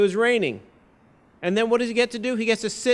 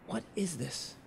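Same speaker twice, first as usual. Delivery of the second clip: much softer, puzzled aside; hushed bewilderment; 3 semitones lower, 13 dB quieter than usual. Retold or imitated. Candidate looking around for the voice, quiet bewilderment. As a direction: ask it under your breath, baffled.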